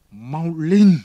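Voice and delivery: a loud, savage roar of a command; beastly roar. A narrator's reading. Javert roaring at the fugitive mayor, like a beast. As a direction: bellow it like a wild beast. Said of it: "Không phải là tiếng người nói, mà là tiếng của ác thú gầm".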